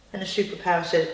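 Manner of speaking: ordinary delivery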